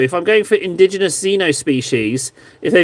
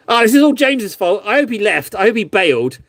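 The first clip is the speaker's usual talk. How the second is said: ordinary talk, nothing marked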